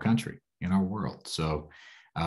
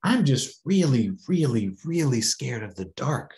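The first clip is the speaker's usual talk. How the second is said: put-on scared child; 5 semitones higher, 4 dB louder than usual. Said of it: "she said".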